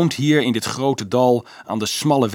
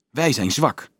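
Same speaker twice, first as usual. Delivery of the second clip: usual speaking voice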